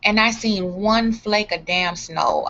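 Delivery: ordinary delivery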